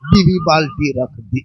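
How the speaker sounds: ordinary delivery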